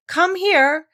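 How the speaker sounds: high, loud call of a governess. Retold or imitated. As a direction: call a pupil from across the house. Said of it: "she would call out".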